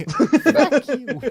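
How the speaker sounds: laughing mock insult. Real, not acted.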